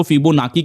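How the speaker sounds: फिबोनाकी with a hard "k"; "k" pronunciation. Shown as a demonstration. Teacher listing how some people say it, फिबोनाकी with "k". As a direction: say फिबोनाकी, ending in "-kee". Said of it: "अलग-अलग pronunciation करते हैं".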